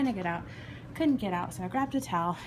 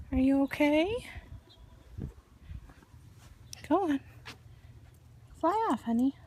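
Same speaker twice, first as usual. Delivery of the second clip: coaxing a wild bird; 5 semitones higher than usual. Real, not acted. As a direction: gently urge the little bird to fly. Coaxing bird to fly away.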